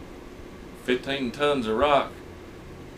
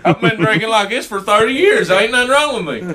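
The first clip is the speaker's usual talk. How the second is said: put-on high, loud hillbilly drunk voice; old drunk voice; 7 semitones higher, 5 dB louder than usual. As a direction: play an indignant old drinker.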